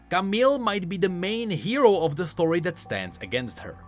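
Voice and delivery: ordinary talk, nothing marked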